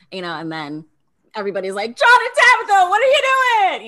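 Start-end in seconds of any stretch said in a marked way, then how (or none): 2.0-3.9 very high, loud shriek of outrage; outraged group shout. Retold or imitated. Contestants yelling at the couple who cost them money, shrill outrage. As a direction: scream it at the couple like furious housemates.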